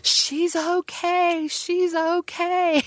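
high, slow, drawn-out cry of relief; imitating a scream. Office lady running across the field to her mother, screaming in relief. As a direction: stretch each word high like a scream of relief. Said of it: "came running and just screaming".

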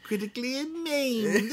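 high, slow, soft ghoul voice into cackle; Crypt Keeper. Acted. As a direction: draw it out as a creepy horror host, then cackle. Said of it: "can't do my Crypt Keeper voice".

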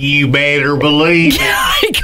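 slow drawn-out Southern shout; southern accent. Retold or imitated. Teasing partner with the sleep-talk line in a slow Southern shout. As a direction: holler it slow like a Southern good ol' boy. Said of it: "the southern accent".

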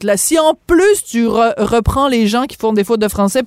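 ordinary talk, nothing marked

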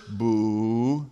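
low disapproving boo. Shown as a demonstration. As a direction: toss in a quick thumbs-down boo. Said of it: "put your thumb down and just go".

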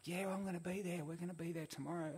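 high-pitched mock American; American accent. Tipsy stranger telling the surfers his plans, high mock American accent. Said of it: "in his American accent".

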